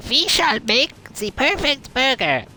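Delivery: high, slow, villainous scientist proclamation; high-pitched evil scientist decree; mad scientist voice. Retold or imitated. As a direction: proclaim it like a cackling mad scientist over his lab. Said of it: "And he goes".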